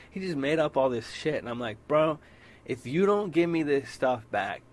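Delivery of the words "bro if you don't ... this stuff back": louder, higher warning; threatening court, raised voice; raised threat. Retold or imitated. Warning the shop guy about court, louder.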